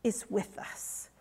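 breathy whisper, slow. Acted. Preacher repeating the truth to the congregation in a whisper.

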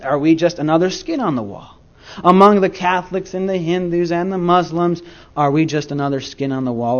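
ordinary delivery

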